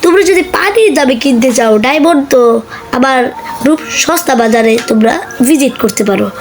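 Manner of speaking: loud upbeat sponsor pitch; ad read. Acted. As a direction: sell it like a promo. Sponsor plug for diamond group.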